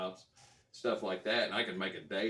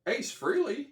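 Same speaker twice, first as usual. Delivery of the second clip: high, loud surprised call of a name; excited call-out; 6 dB louder than usual. Retold or imitated. Fan in the elevator calling out to the star in high, loud surprise. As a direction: blurt his name out, starstruck.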